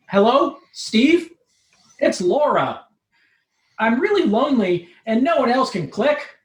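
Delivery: a hint of a lonely girl calling a friend; Laura's voice. Laura's lines in the story.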